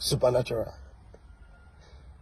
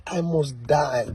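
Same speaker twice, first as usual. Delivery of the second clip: slow and softer, a mock-solemn refusal; mock stubborn insistence; 3 dB quieter, slower than usual. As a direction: drag it out like a stubborn martyr. Mocks believer insisting on death.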